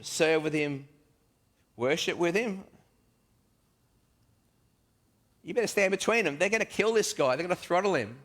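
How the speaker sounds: high, loud, urgent objection; alarmed protest. Acted. Thomas protesting that the Zealots will kill Matthew, high and loud. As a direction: keep the pitch up and press the warning. Continued panic about the Zealots.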